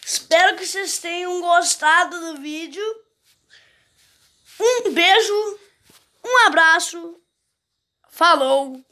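upbeat, drawn-out goodbye to viewers; vlog outro. Acted. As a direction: sign off the video cheerfully. Video sign-off. (despedida animada de vídeo)

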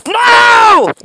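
very high, loud despairing no; anguished shout. Real, not acted. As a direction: yell as the ball is picked. Interception mid-sentence.

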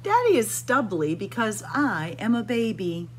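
ordinary delivery

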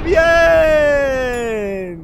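loud, drawn-out high cheer; excited cheer. Pretend. Vlogger hyping viewers for the prank, excited cheer. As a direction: shout it out long and high.

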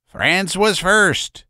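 put-on a fiery old man crying out; violent cry. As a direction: insist hotly on the point. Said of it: "cried violently".

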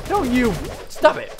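sharp high yell of protest; yelling at enemies. Real, not acted. Gamer yelling at the monsters, loud.

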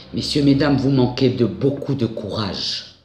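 ordinary delivery

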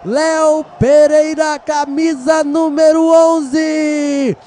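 slow stretched announcement; drawn-out scorer call. Real, not acted. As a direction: stretch each word proudly. Scorer announced after goal.

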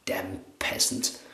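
soft, slow unvoiced hiss of disdain; snob hissed aside. Snob cursing his butler, mock whispered contempt. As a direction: breathe the insult under his breath. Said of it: "the shaving snob".

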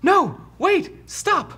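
very high, urgent little voice; desperate orc prisoner. Acted. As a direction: plead from inside a cell in falsetto. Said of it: "you hear".

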